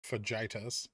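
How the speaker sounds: "fajitas" as fuh-JY-tuz, low and soft; Wisconsin mispronunciation of fajitas. Retold or imitated. Repeating a Wisconsin girl's word, "fajitas" as soft fuh-JY-tuz. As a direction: quietly repeat "fajitas" with a hard j and long i. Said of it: "a girl from Wisconsin who would say".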